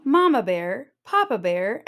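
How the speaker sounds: ordinary delivery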